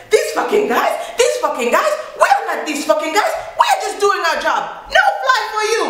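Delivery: very high-pitched offended employee; indignant mock outburst. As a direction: imitate an airline worker taking furious offense.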